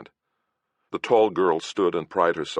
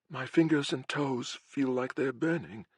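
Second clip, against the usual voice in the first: higher, softer voice in pain; scared young girl, hurting; frightened girl; 10 semitones higher, 4 dB quieter than usual. Acted.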